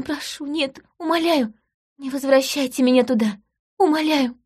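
high, begging voice on her knees; imploring plea. Acted. Runaway wife begs the investigator, imploring. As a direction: plead not to be sent back. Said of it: "протянув ко мне руки, проговорила".

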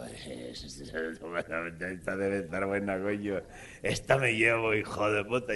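low, very slow scheming voice; sly mutter. Retold or imitated. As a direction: drag it out low like a sly old man. Uncle choosing the film.

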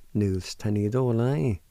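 "Null s ta ni do la i" run together as one word; joined-up pronunciation. Shown as a demonstration. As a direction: blend all the syllables without pauses. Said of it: "like this".

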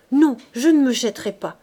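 loud, high panicked "Non, je ne me jetterai pas"; terrified refusal. Acted. Coupeau refusing to throw himself in, terrified. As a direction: refuse in terror. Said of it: "Et il bégayait, les dents claquant d'épouvante".

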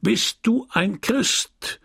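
slow, high catechism chant; booming priest. A narrator's reading. Priest quizzing children in church, slow ringing call. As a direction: ring out the question, drawn out and high. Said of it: "die dröhnende Stimme des Pfarrers".

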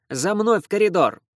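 high, loud order, stress on мной; commanding. Acted. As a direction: bark the order to follow. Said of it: "он властно указал".

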